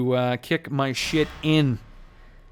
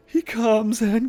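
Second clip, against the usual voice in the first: mock mourning; 11 semitones higher, slower than usual. Mock mourning for fallen Hama.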